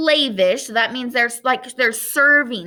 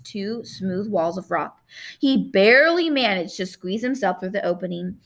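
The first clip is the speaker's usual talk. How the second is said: ordinary delivery, nothing marked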